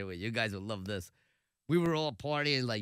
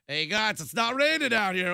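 loud, raised call from below; cop calling up; 7 semitones higher, 7 dB louder than usual. Retold or imitated. Imitating a cop at the billboard's foot, loud call up. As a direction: holler it up to people high overhead. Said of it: "you hear the cop at the bottom of the billboard going like".

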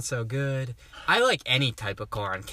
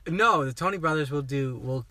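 normal talking voice

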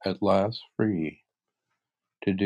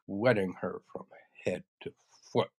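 ordinary delivery

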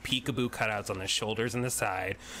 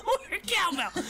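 laughing. Pretend. GM echoes cowbell joke laughing.

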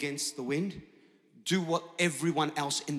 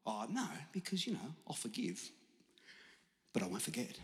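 much softer, hedging voice; mock reluctance; 4 semitones lower, 12 dB quieter than usual. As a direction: back away quietly from the demand. Acts out unwilling forgiver.

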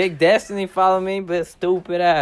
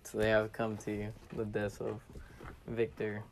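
mock eulogy; 6 semitones lower, 13 dB quieter than usual. Mock funeral eulogy for friend.

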